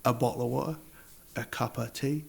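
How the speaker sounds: "a bottle of water, a cup of tea" sin T; dropped T. Shown as a demonstration. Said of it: "No pronuncia ninguna T". (sin ninguna T, acento nativo del sur)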